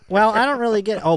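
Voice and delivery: ordinary talk, nothing marked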